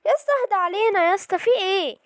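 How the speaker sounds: high-pitched, exasperated pleading; panicked protest. Real, not acted. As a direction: plead in alarm with your attacker. Pleads with attacking game enemy. (باستنجاد واعتراض مفزوع)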